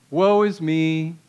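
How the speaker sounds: mock gloomy, drawn-out monotone; hammed-up poor-me lament; Eeyore self-pity. Shown as a demonstration. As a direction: moan it slowly, fishing for sympathy. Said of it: "kind of the Eeyore complex".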